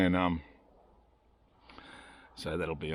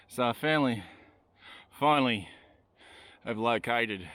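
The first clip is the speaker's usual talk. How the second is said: panting between words after a steep climb; breathless, gasping for air; out of breath; 4 semitones higher than usual. Real, not acted. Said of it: "sorry, I'm out of breath".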